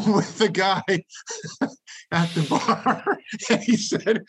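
cracking up mid-sentence; real laughter bubbling through the words; laughing. Real, not acted. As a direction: break into laughter between slow, drawn-out phrases. Cracking up while telling fight story.

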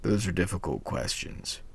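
lightly voiced grandmother; grandmother's voice. A narrator's reading. Grandmother ponders the hero's questions, softly. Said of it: "she replied".

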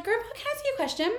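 put-on high, fast, sweet little girl voice. Lilith asking her grandpa, sweet childlike voice. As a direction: chirp it like an innocent child.

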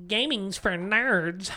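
a hammed-up dismissive friend; mocking friends. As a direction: drag out a snooty put-down. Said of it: "all your friends are like".

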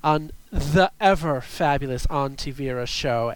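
ordinary talk

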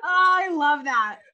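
slow, high words melting into laughter; laughing. Real, not acted. Host to co-host, still laughing at the story. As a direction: savour it while still cracking up.

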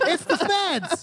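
high, drawn-out crowd yell; mock alarm cry. Game master joins in as fleeing crowd, high mock alarm. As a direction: call it out slow and high. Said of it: "And then they all".

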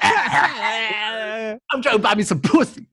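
drawn-out, dragging hustler voice; slow Curtis impression. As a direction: stretch every word like a sleazy drifter.

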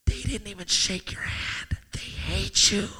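hushed, sly whisper; enemy's whisper. Acted. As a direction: murmur the lie. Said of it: "He's just gonna whisper in your ear".

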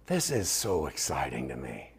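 slow, hushed whisper of wonder; whispering to the congregation; awed whisper. Real, not acted. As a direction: breathe it out in awe. Preacher drops to whisper.

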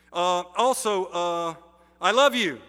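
put-on boy grudgingly tells the kid he loves him, insincere. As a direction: toss it off as insincerely as you can. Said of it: "as insincerely as he could get away with".

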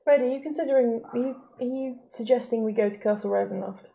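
usual speaking voice

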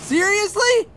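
high, incredulous; exasperated disbelief. Acted. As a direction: cab just ignored you. Cab drives past.

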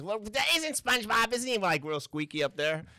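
half-hearted high squeaky SpongeBob attempt; SpongeBob try. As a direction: test the squeaky cartoon voice, unsure. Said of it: "Oh, SpongeBob".

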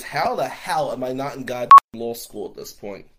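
loud exasperated outburst; frustrated thought. Retold or imitated. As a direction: blurt it out, fed up with himself.

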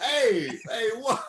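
high, loud Italian-American whoa; Italian-American accent. Retold or imitated. S0 echoing Tony's protest, loud Italian-American accent. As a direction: toss it off like a New York tough. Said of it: "doing this accent".

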